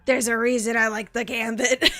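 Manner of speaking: flirty Louisiana drawl, half laughing; Louisiana accent. Pretend. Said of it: "like, Louisiana".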